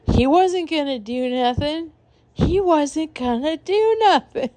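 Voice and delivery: put-on high, slurred drunk voice, slowing on the repeat; drunk mumble. Drunk T Y in the back seat, high slurred bravado. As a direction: slur it like a tipsy friend in the back seat. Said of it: "T Y's in the back being drunk".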